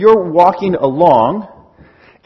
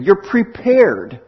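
normal talking voice

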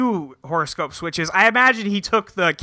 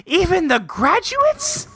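high mock teacher; 11 semitones higher, slower than usual. Teacher voiced in recap.

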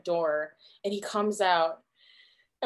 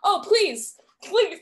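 high-pitched flustered plea; polite pleading; 10 semitones higher, 4 dB louder, slower than usual. Acted. Fan begging the star not to bother, high flustered pleading.

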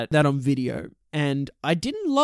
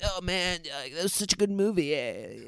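put-on quick, soft Valley accent; 8 dB quieter than usual. Host trying on a mild Valley accent for good. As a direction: rattle it off like a Valley dude. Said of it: "change our accents and just talk like this".

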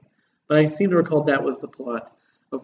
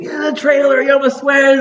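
high, excited geeky gushing; spazzy fanboy gush; 8 semitones higher, 3 dB louder than usual. Retold or imitated. Young fan gushing at a famous director, high and eager. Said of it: "I was, like, the spazzy twenty-year-old".

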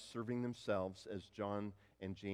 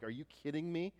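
mock furious; 6 semitones higher, 5 dB louder than usual. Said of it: "these guys are furious".

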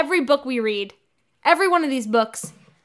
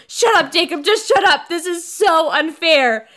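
higher, louder petulant outburst; mock tantrum; 4 semitones higher, 3 dB louder than usual. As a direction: throw a hammed-up teenage fit. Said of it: "And she goes".

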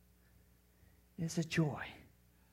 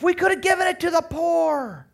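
loud, high hammed-up shout; mock righteous outrage; 13 semitones higher, 8 dB louder than usual. Judas feigning care for the poor, hammed-up outrage. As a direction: cry it out high in phony concern. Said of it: "He's a master deceiver at this point".

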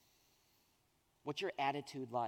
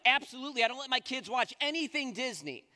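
much higher and louder, absolute refusal; emphatic mom; 9 semitones higher, 7 dB louder than usual. Retold or imitated. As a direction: slam it down with certainty. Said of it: "she's like".

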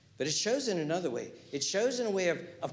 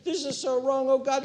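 mock crying prayer; 9 semitones higher, 3 dB louder than usual. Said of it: "they're crying".

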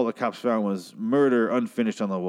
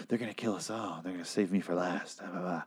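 put-on creepy kid voice; 3 semitones lower, 9 dB quieter than usual. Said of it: "says the creepy little kid thing".

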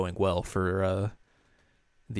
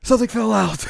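much higher, louder daze; mock airheaded dismay, pitched way up; dazed Yui; 13 semitones higher, 9 dB louder than usual. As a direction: let the words slip out like a lost thought. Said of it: "And she's like".

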